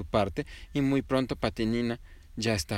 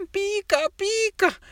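very high, slow whimpering chick; pollito enchilado; 8 dB louder, slower than usual. A narrator's reading. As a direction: whine about the burning. Patinina enchilada se queja. (pollito quejándose agudo y lento)

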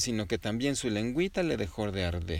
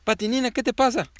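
higher, louder worried dad; papá preocupado; 13 semitones higher, 8 dB louder than usual. A narrator's reading. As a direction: ask in alarm, louder and higher. (papá alarmado, más agudo y fuerte)

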